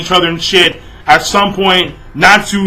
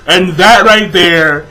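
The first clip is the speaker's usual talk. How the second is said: ordinary delivery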